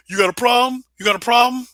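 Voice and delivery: high-pitched mock confrontation; mock challenging moderator. Acting out chat mods challenging him, high mock confrontation. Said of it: "the mods were like".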